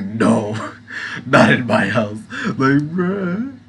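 mock heroic voice. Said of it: "It was like".

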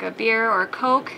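normal talking voice

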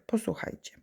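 hushed whisper to the class; soft conspiratorial whisper; whispered call to listen. Real, not acted. Teacher hushing class before story.

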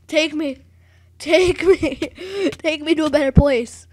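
loud, laughing mock plea; hammed-up cry to be taken away. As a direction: beg theatrically through laughter. Mock plea after failing, laughing.

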